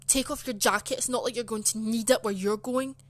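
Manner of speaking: loud, sharp mock telling-off; horrible teacher's order. Teacher to pupil about her jacket, horrible scolding. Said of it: "she starts being horrible to me".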